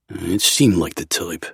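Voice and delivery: ordinary delivery, nothing marked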